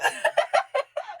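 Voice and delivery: squealing laugh of delight; high laugh. Real, not acted. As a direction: burst into a high giggle. Laughing at the stage seed offer.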